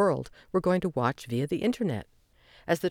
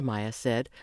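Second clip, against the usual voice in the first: usual speaking voice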